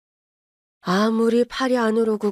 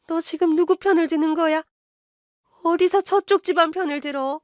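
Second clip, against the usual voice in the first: higher, scolding mother berating her son; mother scolding her son for siding with in-laws, higher and indignant; 5 semitones higher than usual. Acted. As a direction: nag him, pitch climbing with outrage. Narrator voices shrill accusing mother.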